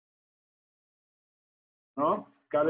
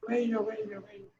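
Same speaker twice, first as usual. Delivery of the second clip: each bello softer like an echo; fading echo; 7 semitones higher, 4 dB quieter than usual. Acted. Teacher imitating the echo for the class, fading repeats. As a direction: let the word die away. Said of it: "¿Y qué oyó Narciso?".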